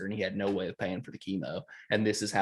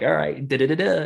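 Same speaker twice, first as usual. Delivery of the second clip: mock keypad tones; 5 semitones higher, 6 dB louder than usual. Mimicking punching in code.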